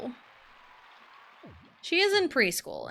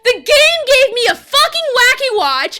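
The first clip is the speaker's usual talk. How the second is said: high, loud furious rant; wailing anger, high and loud; mad and upset; 12 semitones higher, 9 dB louder than usual. Real, not acted. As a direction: shout it in real, mounting exasperation. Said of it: "I'm so mad".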